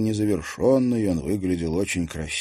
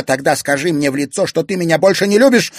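loud, higher, fast demand; 10 semitones higher, 9 dB louder, faster than usual. A narrator's reading. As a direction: challenge him to his face. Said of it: "потребовала она". (требовательно, громко)

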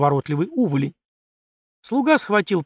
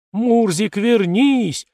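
yelling after the cat, louder and higher; bellowing; 7 semitones higher, 5 dB louder, much slower than usual. A narrator's reading. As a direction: shout for the runaway cat. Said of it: "густым басом орала она".

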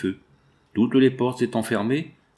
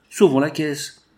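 higher and louder call to rescue the safe; shouted rallying cry; 6 semitones higher, 5 dB louder, slower than usual. A narrator's reading. Foreman urges the workers toward the pavilion, shouting. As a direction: rouse the crowd to save the safe.

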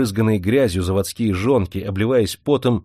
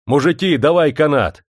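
raised-pitch brisk order; calling out; 5 semitones higher, 4 dB louder than usual. A narrator's reading. Master asking the men for a rope, calling out. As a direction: call for help across a crowd.

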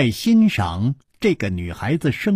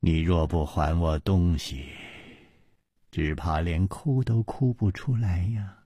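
coldly; 6 semitones lower, 7 dB quieter than usual. A narrator's reading. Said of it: "冷冷地说".

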